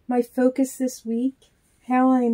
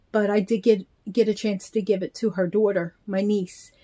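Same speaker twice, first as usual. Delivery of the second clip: ordinary talk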